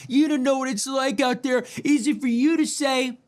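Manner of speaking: high, whining voice of doubters; mocking whiny critics. Mimicking people who say money is hard, in a whiny high voice. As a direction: whine it like a bitter complainer. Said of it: "people go".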